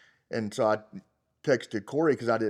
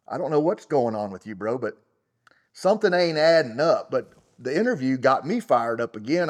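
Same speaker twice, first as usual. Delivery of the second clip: ordinary talk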